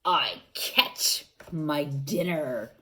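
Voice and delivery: plain reading voice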